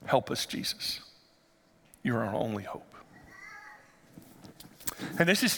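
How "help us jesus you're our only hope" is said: desperate whisper. Retold or imitated. Desperate father begs Jesus.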